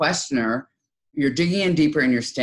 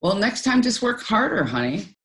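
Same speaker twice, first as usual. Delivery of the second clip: high, brisk know-it-all parent advice; lecturing mom; 7 semitones higher than usual. Retold or imitated. Mom gives unsolicited advice to her venting child, preachy and high. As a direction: hand out the advice like a mom who has all the answers. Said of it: "the mom, responds".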